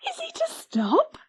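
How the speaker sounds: very high, hopeful squeal; shrieked. Acted. Lizzie asks Patty if the dog stays, a very high squeal. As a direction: squeal it again, hardly daring to hope. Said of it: "shrieked Lizzie".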